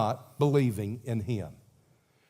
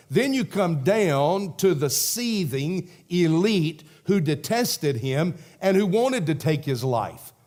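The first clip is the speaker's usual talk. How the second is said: ordinary talk, nothing marked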